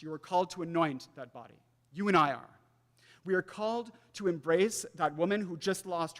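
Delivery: rising, insistent sermon build; preaching at full force. Acted. Preacher urging the congregation to act, fervent and insistent. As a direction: drive each call home to the crowd.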